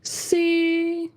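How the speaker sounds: C held at the top of the range; high tone on C, letting go at the end; high level tone. Shown as a demonstration. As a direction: hit C high and hold it. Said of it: "let it go at the end".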